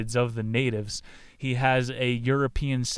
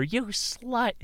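put-on high, sneering mean-girl voice; a mocking high-pitched insult; catty jab; 11 semitones higher than usual. Church women mocking Hester, high sneering voice.

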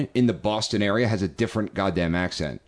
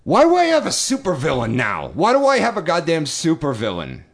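higher-pitched comic outrage; exasperated disbelief; 7 semitones higher, 3 dB louder than usual. Real, not acted. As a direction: cry out in baffled exasperation. Mock outrage after creepy message.

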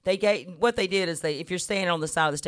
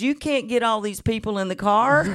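imitating Mama; 6 semitones higher, 6 dB louder than usual. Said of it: "And Mama goes".

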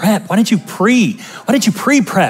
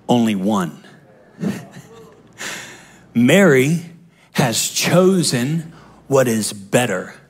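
much lower, soft and slow; Jesus speaking; 8 semitones lower, 4 dB quieter, slower than usual. Acted. Jesus gently correcting Martha, low and soft. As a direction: say it low and tender, as the Lord.

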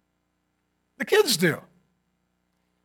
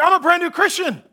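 eager, high blurt; 7 semitones higher than usual. Retold or imitated. Eager nineteen year old.

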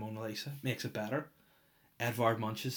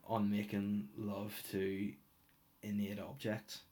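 normal talking voice

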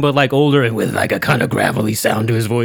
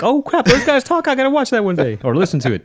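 much higher, startled realization; mock surprise; 11 semitones higher than usual. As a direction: blurt it out like you just remembered. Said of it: "I'm like".

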